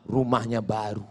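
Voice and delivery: low, soft mock answer; meek complainer. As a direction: confess it low and quiet to the tuyul. Acted complainer answers the tuyul.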